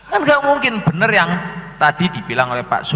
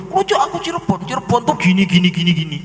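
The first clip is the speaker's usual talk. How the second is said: higher, eager show-off; mock claimant voice; 5 semitones higher, much faster than usual. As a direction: gush like a fake local showing off. Imitating claimant explaining Cirebon. (meniru pengaku Cirebon yang sok tahu)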